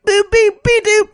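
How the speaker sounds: high, loud silly beeps and boops; high-pitched goofy beep-boop; mock nonsense noises. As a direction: squeak out robot gibberish. Mocking nonsense movie mythology.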